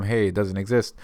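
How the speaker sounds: normal talking voice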